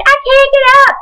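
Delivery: high, whiny mock panic; mock panicked cry. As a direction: squeak it like a helpless girl slipping. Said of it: "she's like".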